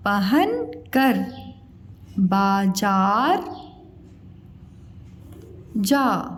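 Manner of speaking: पहन कर बाजार spoken very slowly for writing; slow dictation. Shown as a demonstration. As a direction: dictate each word slowly to the class. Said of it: "dictating slowly". (श्रुतलेख के लिए बहुत धीरे बोलना)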